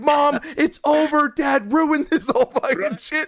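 high, drawn-out complaint; retold exasperation. Son retells complaining to mom about dad, exasperated. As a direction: whine it to Mom in mock despair.

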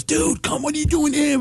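excited buddy greeting. Retold or imitated. Opening act spots his buddy, imitated excited greeting. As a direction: play a friend thrilled to bump into you. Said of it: "I see Artie, and he goes".

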